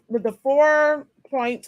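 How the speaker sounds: ordinary talk, nothing marked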